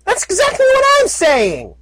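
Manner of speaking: high frustrated yell; exasperated shout. Acted. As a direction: shout at the useless suit. Exasperated filly yells at suit.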